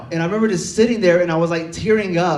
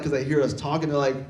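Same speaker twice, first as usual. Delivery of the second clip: ordinary talk, nothing marked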